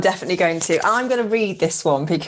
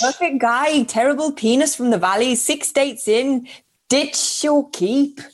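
higher, drawn-out mock Welsh lilt; Welsh accent; 4 semitones higher than usual. Shown as a demonstration. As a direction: read it in a badly faked Valleys Welsh accent. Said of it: "say it in a Wales accent".

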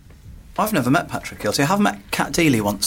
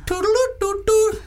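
very high sung toots; imitated pipe tootling. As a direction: tootle like a little whistle pipe. Imitates tune of a whistle pipe.

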